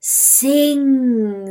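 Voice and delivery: dream voice. A narrator's reading. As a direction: hold the word out loud, like an incantation.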